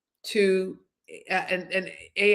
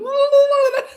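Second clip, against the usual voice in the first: burst of laughter; 7 dB louder than usual. Real, not acted. Laughing at her own jittery nerves.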